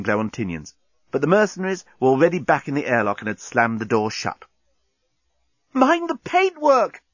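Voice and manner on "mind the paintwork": high, slow horrified gasp; gasped plea. Acted. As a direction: gasp it in dismay. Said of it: "gasped Corporal Inchby Wiglet".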